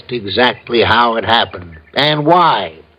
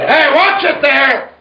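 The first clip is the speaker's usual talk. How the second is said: high, sharp shout of alarm; sudden warning shout; 13 semitones higher than usual. Acted. Sergeant yelling at a driver swerving round the turn, alarmed shout. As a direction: yell it to stop the car in time. Driver nearly hits police car.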